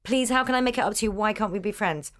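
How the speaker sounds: ordinary delivery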